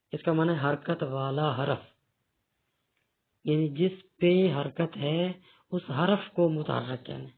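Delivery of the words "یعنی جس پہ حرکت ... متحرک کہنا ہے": ordinary talk, nothing marked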